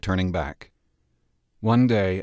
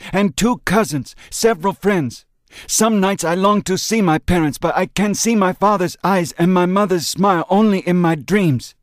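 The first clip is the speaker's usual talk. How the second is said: put-on higher, impassioned voice of the old friend; earnest friend pleading; 9 semitones higher, 4 dB louder than usual. A narrator's reading. Chuan answering Ben's grief with fervent conviction. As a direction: press the truth on him with fervour.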